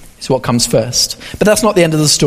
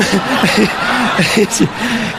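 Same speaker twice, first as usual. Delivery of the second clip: amused laugh. Real, not acted. Laughs at own joke.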